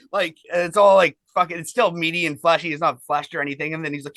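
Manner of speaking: ordinary delivery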